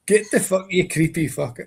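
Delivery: lightly voiced tough Scottish heroine; Scottish woman in a horror film. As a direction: tell the spirit where to go, Scottish style. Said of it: "That's exactly what they would say".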